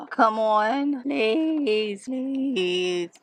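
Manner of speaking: drawn-out begging turning to loud dismay; exasperated pleading. Real, not acted. Vlogger begging a failing printer, exasperated.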